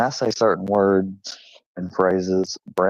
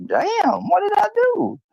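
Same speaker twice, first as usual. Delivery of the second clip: much higher, whiny puppy voice; dog's dismayed thought. Retold or imitated. Dog Brownie rebuffed by the cat, high whiny dismay. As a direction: squeak it out like a hurt pup. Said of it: "had this look on his face like".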